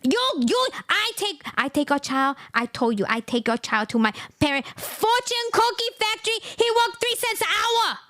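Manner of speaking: greedy mock-accented rant; mock Chinese accent. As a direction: caricature Xi Jinping demanding money. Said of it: "Xi Jinping say".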